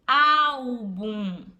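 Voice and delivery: "álbum" loud, with the weight on "ál"; stress on AL. Shown as a demonstration. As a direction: punch the "ál" in "álbum". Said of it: "o mais forte é o au". (sílaba tônica forte em "ál" de "álbum")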